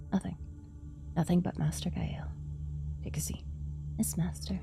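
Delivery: unvoiced entranced whisper; whisper, mind-controlled; hypnotized whisper. Hypnotised by master.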